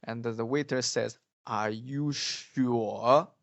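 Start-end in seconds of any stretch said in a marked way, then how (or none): 1.5-3.3 "Are you sure" slowly, dipping then rising on "sure"; drawn-out "sure?" that sags and lifts at the end; questioning third tone. Shown as a demonstration. Teacher models a waiter's doubting question, slow with rising "sure". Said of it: "a British way of saying this".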